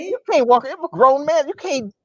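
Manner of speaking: high-pitched retold scolding; mock exasperation. Comedian scolds half-naked roommate in retelling, exasperated.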